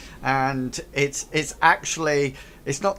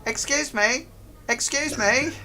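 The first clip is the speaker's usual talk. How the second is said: much higher polite goblin calls; goblin at next table; 10 semitones higher than usual. Acted. Goblin pestering the next table. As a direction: call out hopefully. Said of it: "you hear one saying".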